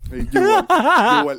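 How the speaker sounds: high, loud laughing agreement. Real, not acted. Agreeing, cracking up.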